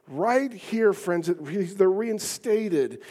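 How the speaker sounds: ordinary talk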